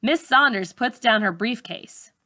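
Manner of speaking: ordinary delivery, nothing marked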